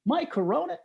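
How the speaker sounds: surprised. Retold or imitated. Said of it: "And people go".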